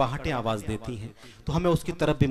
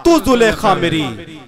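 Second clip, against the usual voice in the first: measured, declaiming recital of a love poem; poetry recital; 9 semitones higher, 12 dB louder, slower than usual. Acted. Poet reciting his nazm to the mushaira audience, declaiming. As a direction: recite each couplet to the gathering.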